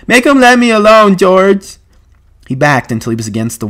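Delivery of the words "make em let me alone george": high, loud pleading wail; frightened childlike bawling; terrified cry. A narrator's reading. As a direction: beg for rescue in a panic. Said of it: "Lenny gave a cry of terror".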